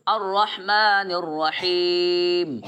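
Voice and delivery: very slow recitation, voice ending on the stop; Quran recitation. Shown as a demonstration. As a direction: chant each word slowly and stop at the end. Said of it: "میں نے وقف کیا".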